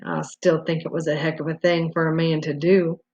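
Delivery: a touch of a gruff hunter's growl; deep growl. A narrator's reading. Hunter keeps grumbling at Benson, mild gruff growl. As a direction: mildly growl it, still sore. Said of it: "The deep voice growled again".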